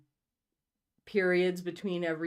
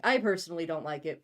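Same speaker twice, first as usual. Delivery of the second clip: ordinary talk, nothing marked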